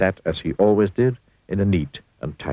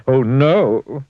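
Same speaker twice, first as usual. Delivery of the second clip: louder, higher horrified "Oh no!"; dismayed cry; 7 semitones higher, 7 dB louder than usual. A narrator's reading. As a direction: cry out at the mess in the bathroom. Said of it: "he gasped".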